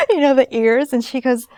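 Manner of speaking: ordinary delivery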